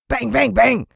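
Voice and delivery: shouted hammering bangs; punchy loud bang bang bang. A narrator's reading. Hammering noise imitated.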